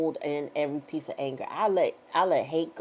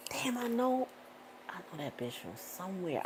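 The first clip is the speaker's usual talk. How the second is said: trailing, much softer mutter; muttered to herself; 11 dB quieter than usual. Retold or imitated. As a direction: say it half to yourself, puzzled. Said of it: "I be like".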